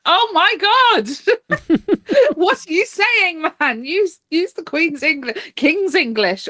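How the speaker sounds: high, drawn-out exasperated outburst; mock exasperation. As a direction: plead in hammed-up despair at someone incomprehensible. Acts out frustration at mumbling farmers.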